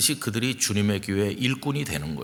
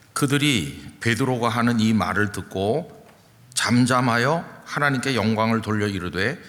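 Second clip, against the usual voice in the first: ordinary talk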